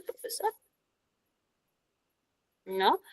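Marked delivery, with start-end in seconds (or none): none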